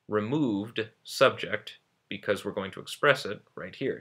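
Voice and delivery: normal talking voice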